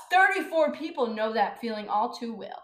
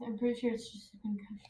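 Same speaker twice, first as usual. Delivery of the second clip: unvoiced whisper; 9 dB quieter, faster than usual. Real, not acted. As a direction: whisper it quietly. Speaks in whisper.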